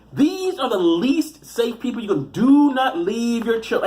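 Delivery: high, slow, exasperated insistence; slow, pleading rant; emphatic warning. Real, not acted. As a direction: lean hard on each word, drawn out. Rants a warning to parents.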